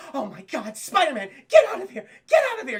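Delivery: hammed-up hysterical shriek, building; mock terror, chasing someone away at full pitch; acted freak-out. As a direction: escalate from gasped shock to high, loud screaming. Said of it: "she's freaking out about him".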